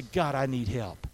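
low, slow prayer for help; honest, sincere plea. Acted. Preacher models crying out to God, low and sincere. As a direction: drop the pitch and plead sincerely. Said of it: "in honesty and sincerity".

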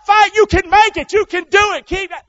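high, loud urging to keep fighting; loud coaching cry. Shown as a demonstration. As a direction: push yourself like a coach. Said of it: "You see the difference?".

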